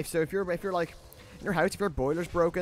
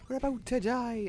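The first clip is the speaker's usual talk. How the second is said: put-on Irish accent; 10 semitones higher than usual. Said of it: "She sounds kinda Irish there".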